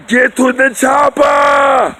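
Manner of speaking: loud, slow, high barked order; Arnold shout. Retold or imitated. Arnold ordering everyone to the chopper, a loud slow shout. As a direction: roar it like an action hero. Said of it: "how Arnold said".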